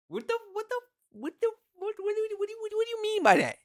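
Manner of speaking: very high-pitched stammering disbelief; high incredulous stammer; indignant disbelief. Real, not acted. Baffled outrage at stereotype question.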